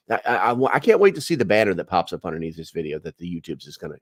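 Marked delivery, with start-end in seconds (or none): none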